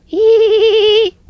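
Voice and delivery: very high, squeaky bird titter; mocking robin giggle. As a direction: cackle down at Toad like a little bird. Robins laughing at Toad.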